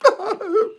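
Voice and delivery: a short, high mocking laugh. Preacher laughing mid-mockery at the government, mocking laugh. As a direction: laugh at the idea of them repenting.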